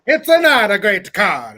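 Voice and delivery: "It's a not a" in a hammed-up Korean accent; joking Korean accent about a bad Hyundai; mock Korean accent. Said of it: "A Korean rickshaw".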